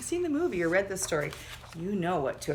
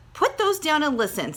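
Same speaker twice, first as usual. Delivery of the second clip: bossy; 9 semitones higher, 5 dB louder than usual. Acted. Bossy sister scolds brother.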